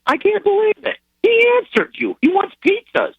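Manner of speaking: very high, excited wife; amazed disbelief. Retold or imitated. Wife phoning husband about the general's reply, amazed. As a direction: blurt the news in a high, astonished voice.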